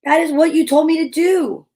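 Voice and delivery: high, loud protest; yelling back. A narrator's reading. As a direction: shout back at mom, upset. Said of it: "I yelled back".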